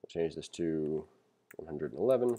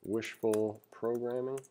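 slow vocab term; slower than usual. Shown as a demonstration. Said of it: "put that into our vocab list".

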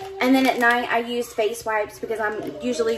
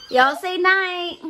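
high, drawn-out "night"; sing-song bedtime prompt; 7 semitones higher, much slower than usual. Real, not acted. Mother prompting the kids to say night, high sing-song.

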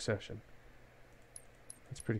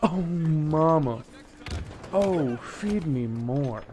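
relishing moan; 3 semitones higher, 8 dB louder, much slower than usual. Real, not acted. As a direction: savour each word loudly and slowly. Relishing kills, drawn out.

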